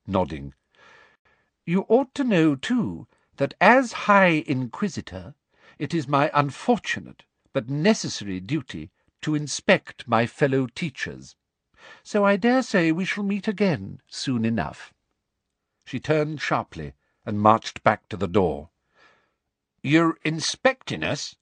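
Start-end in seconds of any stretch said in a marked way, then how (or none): 1.6-14.9 high, sickly sweet and menacing; a prim high threat; Umbridge voice. A narrator's reading. 19.8-21.4 blank echo. A narrator's reading. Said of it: "echoed blankly".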